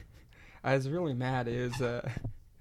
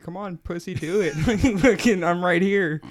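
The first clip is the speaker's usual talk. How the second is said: loud, higher-pitched taunt building in volume; mock goading; 4 semitones higher, 6 dB louder than usual. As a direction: egg him on like a cocky dare. Acted taunt, quoting the veteran.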